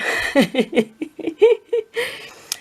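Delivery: high giggling laugh. Real, not acted. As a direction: burst out laughing. Laughing at son's tip.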